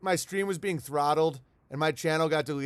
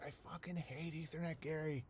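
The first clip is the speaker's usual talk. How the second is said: much softer, muttered; muttered under breath; 16 dB quieter than usual. Retold or imitated. Retelling his own angry remark about Gary, hushed. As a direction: say it almost to yourself. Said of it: "I was like".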